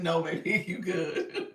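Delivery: put-on loud, laughing comfort to a child; laughing, loud "you good"; laughing reassurance. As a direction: soothe him while cracking up. Laughing, reassuring her frightened son.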